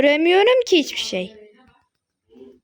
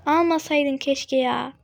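ordinary talk

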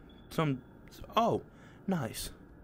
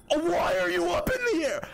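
startled cry; 11 semitones higher than usual. Real, not acted. Player sees the chair float, startled cry. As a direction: yelp it out in shock.

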